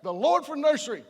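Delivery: loud, high whoop of relief; relieved cheer. Retold or imitated. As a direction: whoop like a parent finally free. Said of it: "You're like".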